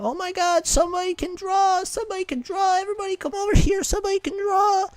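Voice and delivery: very high-pitched squeaky mock child voice; excited kids. Children calling friends over to watch him draw, excited squeal.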